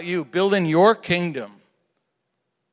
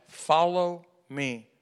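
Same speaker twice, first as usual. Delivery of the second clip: usual speaking voice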